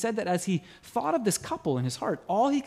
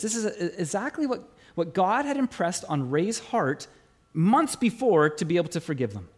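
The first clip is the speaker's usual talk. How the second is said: ordinary talk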